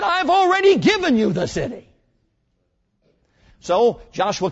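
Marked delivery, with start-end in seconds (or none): 0.0-1.9 high, loud proclamation of already; proclaiming God. Retold or imitated. God telling Joshua the city is given, loud high proclamation. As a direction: ring it out as God's triumphant word. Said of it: "He said".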